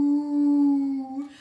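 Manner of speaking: long, loud moo; mooing cow. Acted. Storyteller as cow squeezed into the bed, long moo. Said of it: "the cow said".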